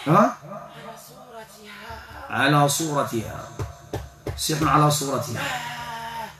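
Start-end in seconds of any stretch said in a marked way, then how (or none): 0.4-1.8 distant, hushed possessed voice; thick strong voice. Real, not acted. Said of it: "بصوت غليظ قوي". 5.4-6.3 distant, hushed possessed voice; thick strong voice. Real, not acted. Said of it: "بصوت غليظ قوي".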